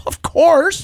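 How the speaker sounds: high, chirpy eager-to-please agreement; squeaky sing-song assent to the boss; mock eager yes. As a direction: answer like an eager yes-man. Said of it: "we go".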